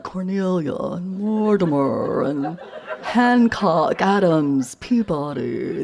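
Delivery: mock Boston Brahmin accent on each name; stretched upper-crust Brahmin accent; blue-blood Boston accent. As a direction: intone the names in a drawn-out Brahmin accent. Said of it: "talked like this".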